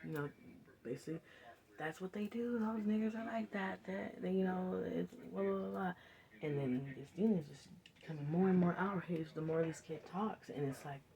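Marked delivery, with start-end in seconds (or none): 1.8-5.9 put-on kid justifying himself. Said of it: "he starts to".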